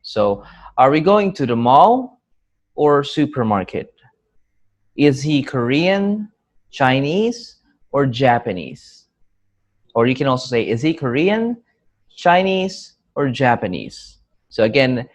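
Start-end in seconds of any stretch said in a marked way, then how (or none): 0.8-3.9 MALL rising, SUPERMARKET falling; rise, then fall. Shown as a demonstration. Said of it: "first intonation is rising". 4.9-9.1 KOREAN and CHINESE rising, JAPANESE falling; falling last option. Shown as a demonstration. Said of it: "has the falling tone". 10.7-14.2 KOREAN rising, CHINESE held high, JAPANESE falling; falling last option. Shown as a demonstration. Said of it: "has the falling tone".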